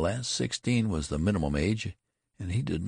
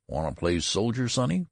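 casual, teasing question rising high on the last word; 6 semitones higher than usual. A narrator's reading. Clerk asks the boy about playing soldier, casual. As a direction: toss it off to a youngster. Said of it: "demanded the clerk casually".

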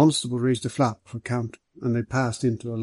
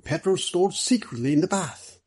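higher, eager guess; excited; 6 semitones higher than usual. Acted. Middleton guessing the answer to Hanno, higher and eager. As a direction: blurt the theory with eager excitement. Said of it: "exclaimed Mister Middleton excitedly".

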